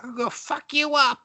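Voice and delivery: high shrieked mock threat; mock angry mom. The mom chasing Wes in a towel, high mock threat. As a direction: screech the threat like a crazed mom.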